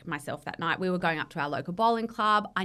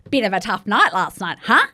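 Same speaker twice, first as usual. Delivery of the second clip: sarcastic mum; 3 semitones higher, 10 dB louder than usual. Retold or imitated. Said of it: "and she goes".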